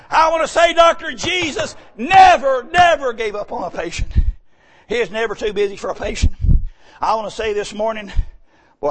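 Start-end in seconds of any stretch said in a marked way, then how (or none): 0.1-4.1 high, loud hammering sermon cry; full-force preaching. Acted. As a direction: preach it out over the congregation, ringing.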